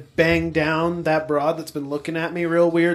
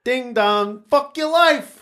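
sing-song doorbell chime then a high snarky line; ding-dong it like a doorbell, then jab; mock doorbell; 6 semitones higher, slower than usual. Friend doing a doorbell bit for the group, playful sing-song.

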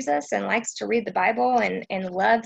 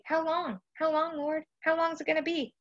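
higher, softer weary plea; pleading; 7 semitones higher, 4 dB quieter than usual. Retold or imitated. Preacher voicing tired believers asking God, soft plea. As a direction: ask it like you're worn out waiting. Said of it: "some of you are asking this question".

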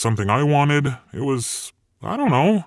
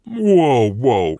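startled devil cuts in; alarmed; 2 semitones higher, 5 dB louder than usual. Acted. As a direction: jump in alarm to stop him. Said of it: "suddenly alarmed".

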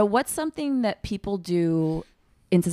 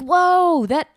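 high, loud sudden delight, slowing; a jolt of pleasure, drawn out; amazed whoa; 7 semitones higher, 5 dB louder, much slower than usual. Retold or imitated.

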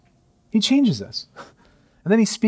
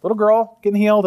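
breezy first reading; 6 semitones higher, 4 dB louder than usual. Retold or imitated. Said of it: "I was like".

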